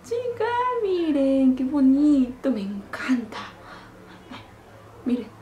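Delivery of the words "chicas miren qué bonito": high, loud, drawn-out squeal of delight; thrilled squeal. Real, not acted. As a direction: gush to your viewers, stretching the words high. Thrilled showing the highlighter to viewers. (chillido de emoción, alargado)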